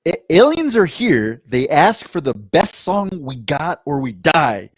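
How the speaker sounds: mock-dramatic alien prompt; dramatic read. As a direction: read it like a movie trailer. Reading a Reddit prompt aloud.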